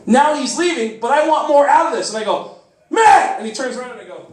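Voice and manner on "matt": high, loud shout of a name; eager fan's sudden yell; calling him back. Acted. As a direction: call out urgently to someone walking away. Calling the departing quarterback back.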